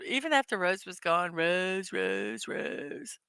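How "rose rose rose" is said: trailing, softer echo of a name; hushed mock refrain; mocking repetition. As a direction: drop it low and soft, fading each time. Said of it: "everything was like, "Rose".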